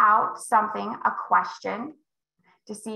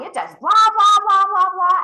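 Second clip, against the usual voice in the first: high, loud rattling off of features; excited sales pitch; 10 semitones higher, 9 dB louder than usual. Acted. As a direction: oversell it like a pushy salesman. Salesperson overloading buyer with features.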